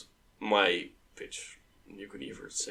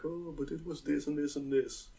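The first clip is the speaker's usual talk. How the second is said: lightly mocking, higher and softer smug voice; 5 semitones higher, 7 dB quieter than usual. Imagining Maarten complaining smugly in the comments, smug mock voice. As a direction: imitate a know-it-all commenter, all smug. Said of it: "being all smug".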